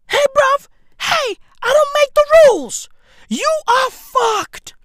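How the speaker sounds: high, loud comic rant; emphatic exclaiming. Real, not acted. Comic warning his bruv there's no hope, emphatic exclaiming. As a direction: push it up high and drag out the last verdict. Drives the verdict home, exclaiming.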